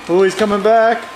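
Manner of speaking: ordinary delivery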